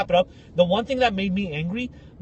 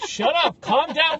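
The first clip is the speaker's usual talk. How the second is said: raised-pitch, dismissive telling-off; mock exasperation; 7 semitones higher, slower than usual. Teammates to the wailing Italian guy, exasperated. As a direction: cut off the drama queen.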